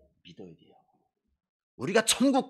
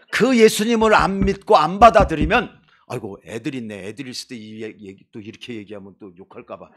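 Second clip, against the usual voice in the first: normal talking voice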